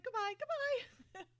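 high sing-song goodbye. Retold or imitated. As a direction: wave goodbye in a high chirpy voice. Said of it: "She's like".

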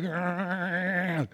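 tired, soft groan of a husband home from work; weary groan. Retold or imitated. Preacher imitating a worn-out husband coming home, groan. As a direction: let out a spent groan. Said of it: "you're just like".

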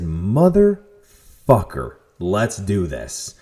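slow, drawn-out self-psyching; acted resolve. Rider psyching themselves up to beat the range, determined. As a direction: stretch the curse, then land the challenge deliberately.

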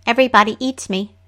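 quick small root voice; a brisk little voice from the creek; trickster tale voices. Acted. Root repeats.

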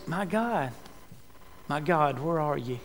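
preacher voicing a lost, quiet question to God; hushed plea. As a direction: ask God almost under the breath.

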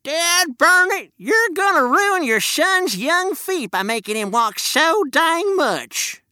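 put-on high, slow old-timer twang; old prospector. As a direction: scold like a cranky miner. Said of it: "old prospector".

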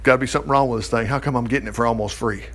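quick, low worried muttering; a fast, low voice of self-doubt; worried inner voice. Retold or imitated. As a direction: rattle off the old suspicion fast. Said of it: "I thought".

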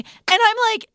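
normal talking voice

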